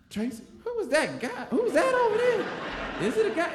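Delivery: very high, puzzled impression of onlookers; nosy kids' voices. Kids ask Tracy about the hooded stranger, high mock puzzlement. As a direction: squeal the question like a baffled kid.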